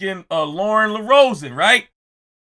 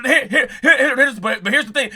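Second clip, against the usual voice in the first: mock stammer; 6 semitones higher, 4 dB louder, faster than usual. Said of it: "You was trying to talk like".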